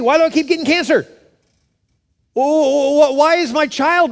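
high, strained cry to God; anguished pleading. Acted. Preacher voicing hurting believers questioning God, anguished. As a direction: hammer out each complaint as a desperate plea.

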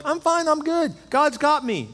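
high-pitched, breezy self-assurance; confident. Retold or imitated. Missionary in Pakistan reassuring a worried pastor by phone, confident. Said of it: "this guy is so confident".

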